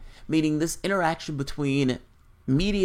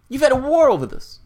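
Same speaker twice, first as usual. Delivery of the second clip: high, loud exasperated outburst; heated rant; 8 semitones higher, 8 dB louder than usual. Real, not acted. Lecturing British viewers on the royals, loud heated rant. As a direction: push it up and out, incredulous.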